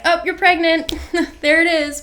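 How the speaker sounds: high, loud mock announcement of a result; punchy imitation of a test announcing itself. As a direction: blurt it out like an instant verdict, half laughing. Voices the pregnancy test's instant verdict.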